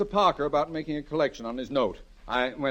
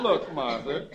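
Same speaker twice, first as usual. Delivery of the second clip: usual speaking voice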